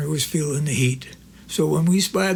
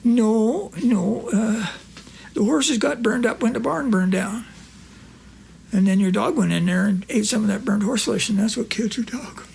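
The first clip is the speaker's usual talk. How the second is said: higher, lightly voiced old country friend; Corky's voice; 4 semitones higher than usual. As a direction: play the innocent old pal.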